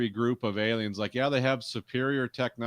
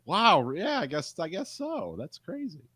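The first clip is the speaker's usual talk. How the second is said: much higher, faux-impressed; a hint of mock wonder, higher pitched; mock amazement; 8 semitones higher than usual. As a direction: play it wide-eyed and gullible. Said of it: "It's like".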